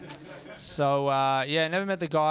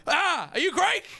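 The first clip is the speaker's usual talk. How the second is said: much higher, mock eager bro voice; Greek dude; 13 semitones higher than usual. Greek dude asking the comic, high mock voice. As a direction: squeak it out like a keen Greek bro. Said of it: "They're like".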